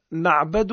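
"نعبد" as naʕbadu, a after the b; fatha. Shown as a demonstration. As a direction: open middle vowel in "نعبد". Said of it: "بالفتح".

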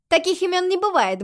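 put-on high, loud perky girl; cheerful. A narrator's reading. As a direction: answer brightly and briskly. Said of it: "бодро отозвалась Айрис".

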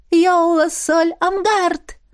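high slow ringing incantation; echoing ethereal energy being. A narrator's reading. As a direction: chant the spell in answer. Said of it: "В тон ему ответила искра". (звонкое заклинание, в тон)

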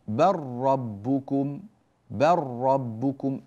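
بل ربكم as barrabbukum, no lam; slow, drawn double ra in بل ربكم; lam merged into ra. Shown as a demonstration. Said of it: "اللام خلاص ذهبت تمامًا وأدغمت في الراء".

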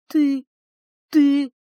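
usual speaking voice

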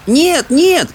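high shouted no; shouting. A narrator's reading. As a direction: call out high over the water. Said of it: "кричала им Софи".

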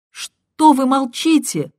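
frantic woman yelling at a mute line; shouting. A narrator's reading. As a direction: shout at the silence. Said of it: "кричала она".

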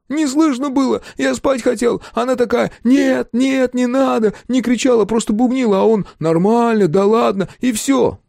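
high, loud, frantic outpouring; desperate, high-pitched yelling; full-voiced cry. A narrator's reading. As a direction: shout it out in panic. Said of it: "закричал уже в голос".

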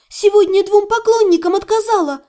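high, upset protest; trembling voice. A narrator's reading. Heroine justifying herself to her fiancé, high and mildly trembling. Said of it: "сказала я дрожащим голосом".

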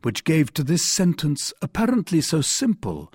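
ordinary delivery, nothing marked

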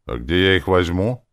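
mild grumpy protest; grumbling. A narrator's reading. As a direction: complain at being asked. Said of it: "проворчала чертовка".

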